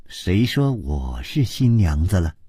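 usual speaking voice

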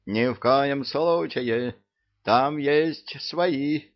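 put-on high, slow old woman's protest; lightly voiced old lady objecting; fussy old lady. A narrator's reading. Old lady corrects the plan. (лёгкий голос старушки)

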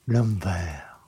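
plain reading voice

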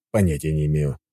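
ordinary delivery, nothing marked

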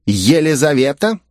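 high, astonished question; surprised. A narrator's reading. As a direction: ask it taken aback. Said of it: "удивился Ято".

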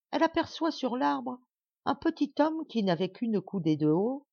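usual speaking voice

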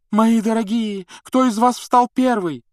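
high, loud, alarmed cry. A narrator's reading. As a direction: shriek out the question. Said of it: "громко вскрикнула".

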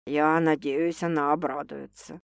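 low, soft prediction; gentle. A narrator's reading. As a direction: offer it softly, hopefully. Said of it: "мягко спрогнозировал он".

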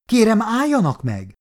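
high urgent call to halt; shouted plea. A narrator's reading. Nurse shouting at soldiers to stop, high urgent plea. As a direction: cry out to make them stop. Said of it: "Kiáltása meglepte".

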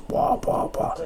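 breathy whispered pua pua; faint whispered puffs; whispered puffing noise. Retold or imitated. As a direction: puff the syllables out in a whisper. Imitating noise in hospital bed.

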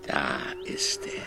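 hushed, breathless alert; urgent whisper. Acted. Man secretly alerts others that the eel is here. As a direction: point it out under your breath.

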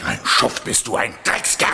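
furious name-calling; angry outburst. Acted. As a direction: throw the insult right at him. Friend insults the lying driver.

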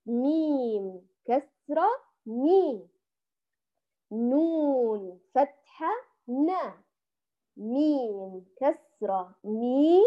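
each letter and its vowel named slowly, ميم كسرة مي; slow spelling out. Shown as a demonstration. Teacher spells out the word for her students, slow. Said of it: "نأخذها بالتهجي".

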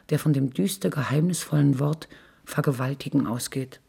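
usual speaking voice

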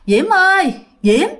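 high, carrying call of a name. Retold or imitated. Ghost mother calling Diễm from outside. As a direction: call her from outside the house.

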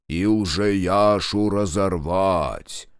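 slow, drawn-out ritual chant; trained orator voice. A narrator's reading. Minkult leads the ritual, stately intoning. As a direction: intone it slowly like a ceremony leader. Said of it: "заговорил поставленным голосом".

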